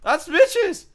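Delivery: high, loud startled yell; sudden shout. Real, not acted. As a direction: yell out in alarm. Teammate grabs Mitch's dropped axe.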